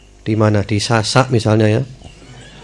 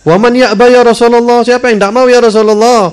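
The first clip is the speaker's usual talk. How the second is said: much higher and louder, an eager questioning voice; companions asking; 11 semitones higher, 5 dB louder than usual. Retold or imitated. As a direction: call out the question like an astonished follower. Companions ask the Prophet. (sahabat bertanya, jauh lebih tinggi dan keras)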